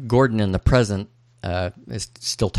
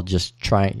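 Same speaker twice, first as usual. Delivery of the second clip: ordinary delivery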